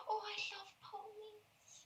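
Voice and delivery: high, hushed, drawn-out gasp; gasp, then breathe it slowly in wonder; awed little girl. Acted. Girl hears of horses, awed high whisper. Voices the girl adoring ponies.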